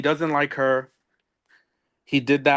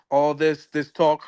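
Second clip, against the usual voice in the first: ordinary talk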